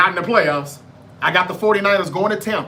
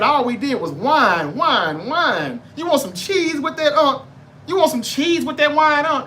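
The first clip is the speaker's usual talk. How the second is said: high, whiny mock taunt, much higher on the cheese lines; mocking Eagles fans; 6 semitones higher than usual. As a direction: taunt like a smug rival fan. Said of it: "Eagles fans said".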